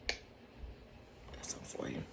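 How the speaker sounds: unvoiced conspiratorial whisper. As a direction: breathe it like a secret. Secret aside while she slips away.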